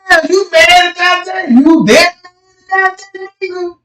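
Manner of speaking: high, drawn-up retold disbelief; hammed-up high protest; mock incredulity. Acts driver arguing with cop.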